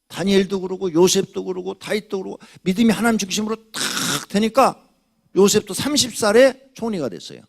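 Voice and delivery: preaching to the congregation with rising force; fervent preaching. Acted. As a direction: build the point with rising fervor. Pastor preaching at full force.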